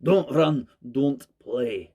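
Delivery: hint of a Scottish manager's accent; mock Scottish accent barking an order. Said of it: "albeit in a Scottish accent".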